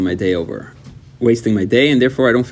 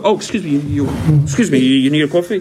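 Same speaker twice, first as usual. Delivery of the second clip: higher, fussing politeness; overeager gentleman; 4 semitones higher than usual. Retold or imitated. Offering coffee to every girl, overeager gentleman. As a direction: stammer a courteous offer, mock-gallant. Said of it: "I become such a gentleman".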